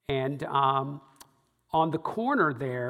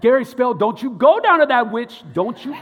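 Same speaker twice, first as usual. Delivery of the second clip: much higher, much louder panicked scolding; freaked-out warning; 9 semitones higher, 12 dB louder than usual. Retold or imitated. Coworker scolding him about the witch, panicked and loud. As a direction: shriek a warning at him, laughing at the end. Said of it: "Norma was flipping out".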